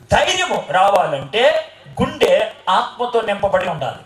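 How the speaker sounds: slow, weighty, each word laid down; slow emphatic line. Shown as a demonstration. Preacher to congregation, slow and emphatic. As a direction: drive the point home slowly.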